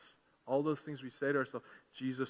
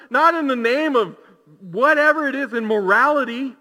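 shouted, high-pitched rallying cry to the church; rally the congregation at the top of your voice; very loud, fervent pulpit exhortation; full-force preaching; 12 semitones higher, 15 dB louder than usual. Real, not acted.

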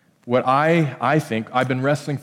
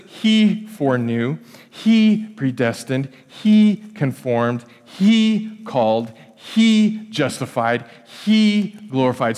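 slow, each he leaned on in turn; stressing he; 2 semitones lower, slower than usual. Shown as a demonstration. Pastor pointing the congregation to God's verbs, emphatic. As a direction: hit every he, one by one. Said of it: "it says".

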